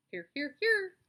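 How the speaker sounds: high, loud honking triple call; gander honking. Acted. The gander answers the roll, loud and honking. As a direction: honk it three times like a goose. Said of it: "said the gander".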